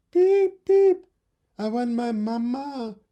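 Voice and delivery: very high, pleading chick voice; crying baby bird. Acted. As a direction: whimper for mamma like a baby bird. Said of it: "said the baby bird".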